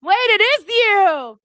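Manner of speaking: loud, high surprised cry; surprised realization, loud and high. Real, not acted. Surprise at the fan video.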